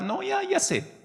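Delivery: high, brushing-off already-know-it; dismissive. Pretend. A complacent believer waves off the preacher, high and dismissive. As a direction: wave it away, high and quick.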